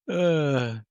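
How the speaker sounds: high, drawn-out words through laughter; really laughing, stretching the words slowly; cracking up. Real, not acted. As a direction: squeeze it out between laughs. Helpless laughter at own story.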